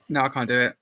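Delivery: ordinary talk, nothing marked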